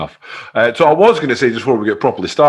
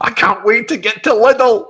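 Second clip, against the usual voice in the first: high, giddy and laughing; mock excitement; 11 semitones higher, 4 dB louder than usual. Host joking eagerly about a trip to Lidl, high. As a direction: gush it like a huge treat.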